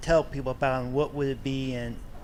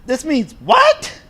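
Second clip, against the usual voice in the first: loud, high, slow disbelief; baffled outburst; 11 semitones higher, 12 dB louder, much slower than usual. Acted. Fan puzzling over a dictionary definition, exaggerated bafflement. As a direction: act dumbfounded, punching it up high and loud.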